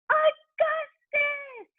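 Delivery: long, slow, very high wail; high drawn-out father's cry; anguished cry. A narrator's reading. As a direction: drag the name out in alarm. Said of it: "cried Mister Gloop".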